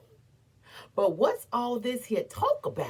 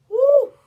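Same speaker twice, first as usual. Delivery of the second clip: sudden high, loud anguished cry; high wail; 12 dB louder than usual. Acted. Crying out in grief.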